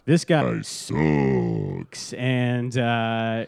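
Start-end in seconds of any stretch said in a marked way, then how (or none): 0.1-2.1 low, slow dismissive drawl; unimpressed verdict. Retold or imitated. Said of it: "and we were like".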